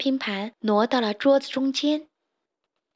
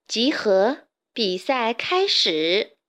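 ordinary delivery

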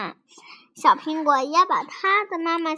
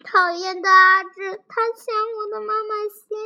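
higher-pitched, mildly acted crying child; tearful complaint; 5 semitones higher, 7 dB louder than usual. A narrator's reading. 小苹果 walking and crying to mom, mock-tearful complaint. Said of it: "一边哭着说".

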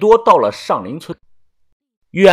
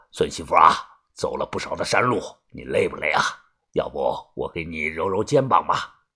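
put-on much lower, softer doting old man; concerned old grandpa; 10 semitones lower, 6 dB quieter than usual. A narrator's reading. Grandpa asks tired granddaughter-in-law, low doting concern. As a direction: coax her gently like a fussing grandfather. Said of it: "李爷关心地问道".

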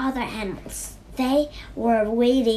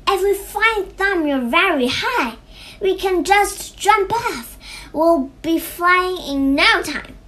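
loud, high, excited planning; rally a friend with the brilliant plan; keyed-up storybook crow; eager excitement; 7 semitones higher, 6 dB louder than usual. A narrator's reading. Crow eagerly plans to fly.

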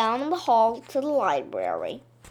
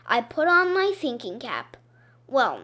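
ordinary delivery

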